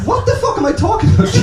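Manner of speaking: sudden squeaky high question; mock bewildered. Real, not acted. Comic asking the crowd, squeaking way up high. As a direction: pitch up high, baffled at yourself.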